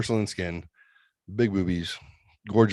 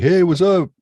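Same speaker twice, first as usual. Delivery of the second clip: put-on high, loud young kid greeting; mock young gamer hello; 12 semitones higher, 9 dB louder than usual. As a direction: chirp it like an excited kid. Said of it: "They're like".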